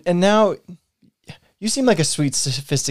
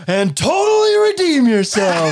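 slow, drawn-out elated exclamation; jubilant; 3 semitones higher, slower than usual. Acted. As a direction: burst into delight, stretching the words. Mock scolding flips to delight.

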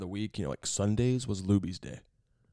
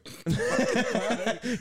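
big laugh; 12 semitones higher than usual. Real, not acted. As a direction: crack up at the joke. Laughs at joke.

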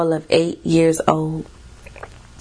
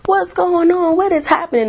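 high alarmed cries, much higher; mock panicked screaming; 10 semitones higher than usual. Therapist's coworker screaming in alarm, mock panic. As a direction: squeal it like a frantic woman. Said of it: "The woman's just screaming".

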